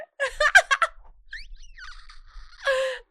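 laughing hard. Real, not acted. Reacting to shocking story line.

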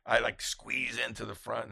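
usual speaking voice

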